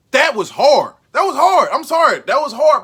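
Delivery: loud, hyped exclamation. Real, not acted. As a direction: shout it like the line hit you. Hyped reaction to quote.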